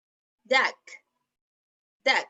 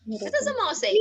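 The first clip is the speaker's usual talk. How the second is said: quick, high little voice; high-pitched repeated question; 8 semitones higher, 4 dB quieter, faster than usual. Shown as a demonstration. Teacher prompting children for the mouse sound, high-pitched. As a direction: ask it high and in one go.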